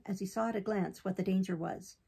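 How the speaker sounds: usual speaking voice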